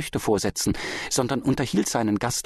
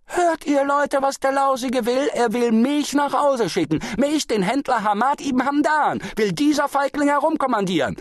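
very high, loud public bluster; puffed-up, strained high shouting; roaring; 4 dB louder than usual. A narrator's reading. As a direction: bellow to the crowd in outrage. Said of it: "brüllte er".

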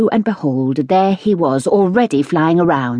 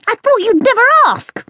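high squeaking little animal voice; a guinea pig squeaking with glee; squeaky guinea pig; 5 dB louder than usual. A narrator's reading. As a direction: squeak it out, tiny and eager. Guinea pig's squeaky reply.

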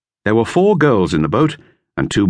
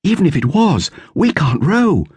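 higher, lightly voiced girl; girl's voice, lifted higher; Dorothea; 10 semitones higher than usual. A narrator's reading. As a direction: read it as young Dorothea. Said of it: "said Dorothea".

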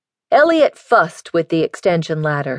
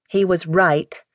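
usual speaking voice

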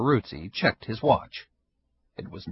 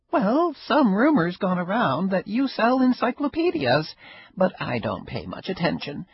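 higher, lightly voiced old woman, wry and amused; old Ida, sly; 9 semitones higher than usual. Ida needling her neighbor, a higher sly old-woman voice. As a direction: play the sly old neighbor enjoying herself.

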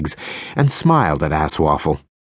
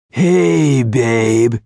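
higher, oily flirt; sleazy pickup voice; 5 semitones higher, 4 dB louder than usual. A narrator's reading. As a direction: slide in like a cheesy charmer. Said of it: "Sunglasses said".

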